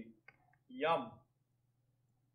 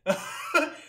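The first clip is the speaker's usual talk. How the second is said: pained laugh; 11 semitones higher, 4 dB louder than usual. Real, not acted. Laughing after eating wasabi.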